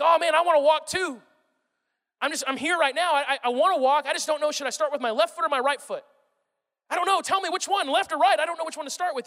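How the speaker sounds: high, loud, flustered pleading; hammed-up helpless indecision, begging to be told; fretful eagerness. Would-be walker asking which foot goes first, flustered.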